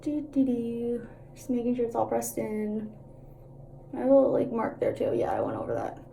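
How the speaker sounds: talking from side of mouth; lopsided, half-closed mouth; out of mouth corner. Real, not acted. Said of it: "I talk out of the side of my mouth".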